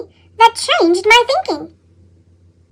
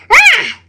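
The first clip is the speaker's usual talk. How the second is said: frustrated cry; 5 semitones higher, 4 dB louder than usual. Acted. Frustrated after late bus.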